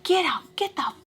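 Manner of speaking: a furious bus driver kicking them out; pissed off. Retold or imitated. Bus driver yelling at the girls, angry mock yell. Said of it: "Like, pissed off".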